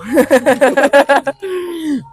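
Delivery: long bubbling laugh. Real, not acted. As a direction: burst into a run of giggles. Laughs while explaining to friends.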